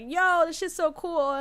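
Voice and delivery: thrilled friend, mocking his enthusiasm; excited friend. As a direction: imitate him loving it. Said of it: "He was like".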